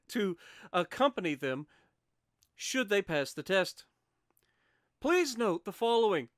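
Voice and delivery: ordinary talk, nothing marked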